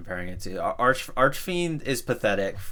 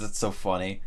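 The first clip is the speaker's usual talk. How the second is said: normal talking voice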